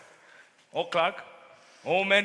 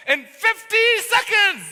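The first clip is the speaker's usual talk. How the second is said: drawn out and ringing, much higher and louder; grand flourish; 11 semitones higher, 9 dB louder, slower than usual. Acted. Salesman dazzling the mayor with a grand flourish. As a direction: land the boast like a big finish.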